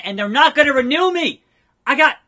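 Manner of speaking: loud, high protest at bad news; outraged disbelief. Wrestler learns deal is up, outraged. Acting out losing his contract.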